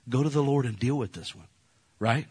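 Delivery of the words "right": usual speaking voice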